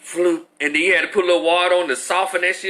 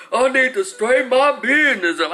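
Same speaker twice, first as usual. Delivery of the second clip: put-on small kid yelling his line, a bit higher; little boy voice; 5 semitones higher than usual. Substitute teacher imitating a little boy, higher. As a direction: play the tiny kindergartner demanding it. Child voiced in classroom story.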